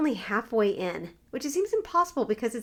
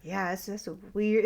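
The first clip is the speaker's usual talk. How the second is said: ordinary talk, nothing marked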